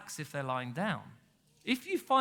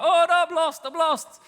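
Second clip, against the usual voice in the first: much higher, louder mock panic; panicking sheep; 9 semitones higher, 7 dB louder than usual. Preacher voicing the lost sheep running in panic, mock frantic bleat. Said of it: "panicking and like".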